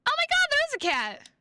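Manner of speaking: delighted high squeal; excited. Acted. Hears meow, thrilled.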